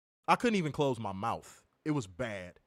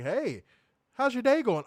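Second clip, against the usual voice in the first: cheery cashier; 9 semitones higher, 5 dB louder than usual. Retold or imitated. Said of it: "Motherfucker like".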